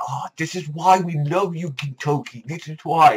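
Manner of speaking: playful high fangirl swoon; mock adoring gush. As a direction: coo over him like a smitten fan. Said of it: "And I'm like".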